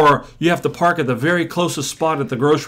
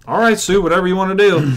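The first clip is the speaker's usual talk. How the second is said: humoring, placating; 5 semitones higher, 3 dB louder, faster than usual. Retold or imitated. Humoring a skeptical patient.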